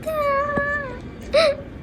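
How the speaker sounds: high, soft drawn-out wail; put-on whimpering sobs; whining cry. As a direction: wail like a sulking child. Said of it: "Quit whining".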